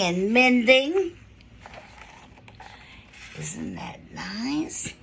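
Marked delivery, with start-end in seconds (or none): 3.4-4.9 much lower and hushed, slow; soft aside. Real, not acted. Reader pausing the story to the listeners, hushed and slow. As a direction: murmur it almost to yourself.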